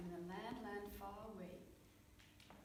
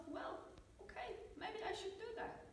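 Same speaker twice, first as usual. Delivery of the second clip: higher, hesitant little prince voice; young prince thinking; 12 semitones higher, 5 dB quieter than usual. Acted. Prince reluctantly convinces himself, in a higher tentative voice. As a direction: talk yourself into it, unsure.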